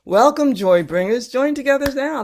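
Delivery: bright recap. Shown as a demonstration. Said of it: "third rendition".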